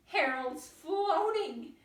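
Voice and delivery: gasping. A narrator's reading. Missus Duck seeing Harold float, amazed gasp. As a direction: gasp with delight. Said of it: "gasped Missus Duck".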